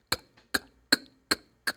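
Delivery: "k" as a voiceless click of breath; sound of K. Shown as a demonstration. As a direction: repeat "k" as a bare consonant, no vowel. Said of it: "what is the sound of letter K?".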